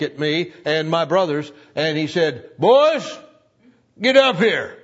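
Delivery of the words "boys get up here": put-on loud, high call to the boys; stern call. Dad calling sons up from the pond, stern loud call. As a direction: summon the boys sharply. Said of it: "when he said it like that".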